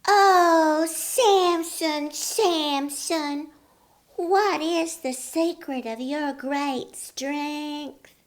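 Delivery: high, slow coaxing woman's voice; sweet-talking Delilah. A narrator's reading. Delilah coaxing Samson for his secret, high and sweet. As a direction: coo each word to lure the secret out. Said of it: "She said to him".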